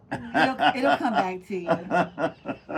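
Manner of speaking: laughing through the words; slow, chuckling reassurance. Real, not acted. Laughing at co-host forgetting.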